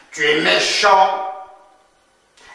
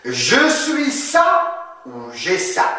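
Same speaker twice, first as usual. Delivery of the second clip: slow teaching question; 7 semitones higher than usual. Shown as a demonstration.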